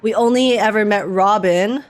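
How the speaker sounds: ordinary talk, nothing marked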